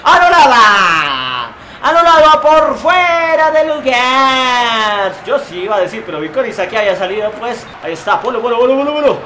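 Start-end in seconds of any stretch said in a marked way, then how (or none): 0.0-5.1 drawn-out call. Real, not acted. Goal disallowed, drawn-out call.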